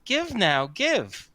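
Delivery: high, slow taunting fairy. Acted. Fairy taunting the bard after his failed song, high and mocking. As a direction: sneer at him like a spiteful little sprite. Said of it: "it says".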